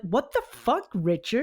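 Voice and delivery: high, exasperated yelp at a friend; mock outrage. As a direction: toss it off like a stunned bystander. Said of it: "the guy's like".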